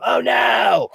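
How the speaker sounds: high, loud cry of alarm; scream. Shown as a demonstration. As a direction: shriek it in panic. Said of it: "if you hear me scream".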